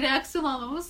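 ordinary talk, nothing marked

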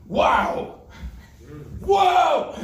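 high, loud exclamations; mock awe. Preacher reacts to a list of names, hammed-up amazement. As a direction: whoop it as if bowled over.

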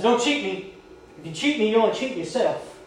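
loud, high scolding. Retold or imitated. Drill leader scolding recruit.

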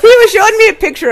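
high, loud, laughing through it. Real, not acted. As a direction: tell it while cracking up. Laughing while telling the story.

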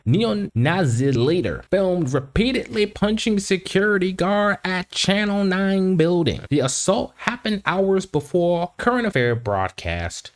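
high, formal headline reading. Acted. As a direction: read it out like a news headline. Reads headline aloud.

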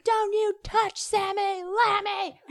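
put-on high, slow, indignant child voice; angry little kid. Child voice yelling at the band's drummer, high and indignant. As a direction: scold like a tiny kid defending her toy. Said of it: "just be like".

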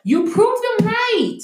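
high, slow, heated stress on proved; heated emphasis. Real, not acted. Ranting to the co-host about the killer, heated and slow. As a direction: hammer the point home with anger.

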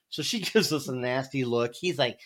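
ordinary delivery

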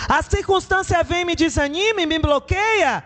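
fiery preaching. Acted. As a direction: preach it like a pastor rousing the church. Preacher rises to full force.

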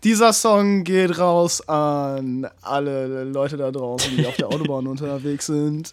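low, slow trucker radio DJ. Pretend. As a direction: play a late-night radio host. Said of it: "Trucker-Radio".

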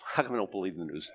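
low, soft, quick repeat of a question; subdued rhetorical comeback; incredulous echo. Real, not acted. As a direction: throw the question back under your breath. Echoes critic's question back.

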